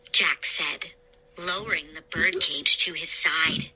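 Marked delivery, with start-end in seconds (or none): none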